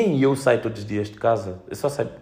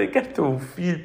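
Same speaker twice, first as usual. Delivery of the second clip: ordinary delivery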